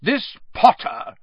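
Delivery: put-on high, loud drunken demand; loud and pointed; Aunt Marge, loud. A narrator's reading. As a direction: boom his name across the table. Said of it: "said Aunt Marge loudly".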